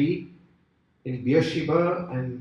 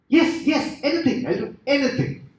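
high, frantic agreement; desperate eagerness; 10 semitones higher, 4 dB louder than usual. Acted. Dangling man agrees to God's one condition, high and desperate.

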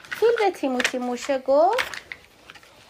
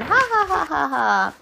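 monkey laughter; 4 semitones higher, slower than usual. Acted.

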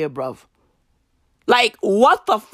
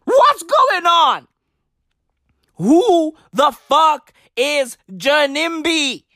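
higher, slow, drawn-out shout; slow incredulous yell; 5 semitones higher, slower than usual. Acted. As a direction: stretch each word in disbelief. Exasperated rant continues.

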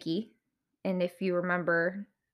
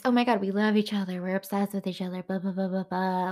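fast, dismissive parody of devotion; mock gushing; faster than usual. Viewer mimicking a couple's showy love, quick dismissive mockery. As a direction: rattle off the love talk like empty chatter.

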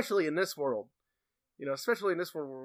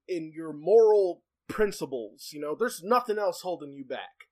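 ordinary delivery

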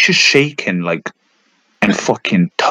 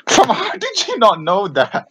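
high, slow laughing disbelief; 10 semitones higher than usual. Real, not acted. Mocking friend's ignorance.

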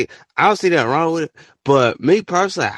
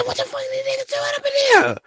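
shrieking disbelief. Retold or imitated. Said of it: "I said".

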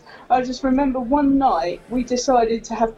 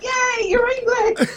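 mock cheer; 13 semitones higher than usual. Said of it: "they're like".

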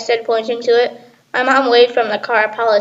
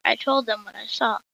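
ordinary delivery

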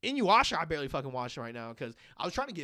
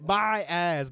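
ordinary talk, nothing marked

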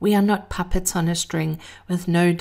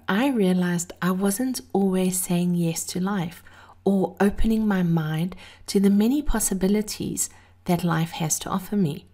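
ordinary talk, nothing marked

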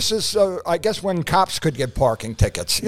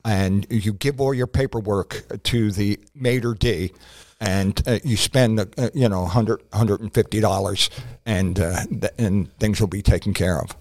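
low-voiced wink-wink tip-off from a fixer; fixer's advice; 9 semitones lower than usual. A fixer tips off the comic about the restaurant, low and knowing. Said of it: "a certain person told me".

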